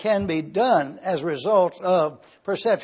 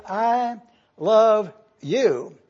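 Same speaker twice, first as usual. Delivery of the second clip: "I love you" very slow and drawn out, lingering on "love"; emphasis on I; 3 semitones higher, much slower than usual. Shown as a demonstration. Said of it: "the emphasis is not on you".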